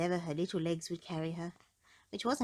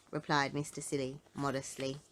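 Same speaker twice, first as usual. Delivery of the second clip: ordinary delivery, nothing marked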